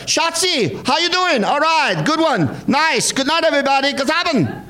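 very high, camp German showman voice; over-the-top German award winner. Pretend. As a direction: gush at the crowd like a giddy German celebrity. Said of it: "storm the goddamn stage and be like".

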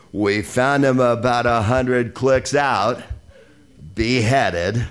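slow John Wayne drawl; John Wayne impression. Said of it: "John Wayne clips".

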